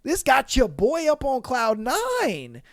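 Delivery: a guy loving the attention, hammed up; mock Jimmy savoring it. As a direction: stretch each word out like you're basking. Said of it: "he was just like".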